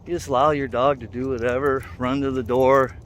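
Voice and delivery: ordinary delivery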